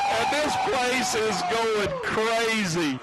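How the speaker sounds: high, slow, thrilled call; excited. Real, not acted. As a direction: call it like the crowd is roaring. Play-by-play after 50-yard kick.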